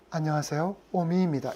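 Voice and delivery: mild impression of a radio presenter; radio announcer voice. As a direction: give a soft, low hint of an announcer's greeting. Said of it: "이런, 이런 목소리예요".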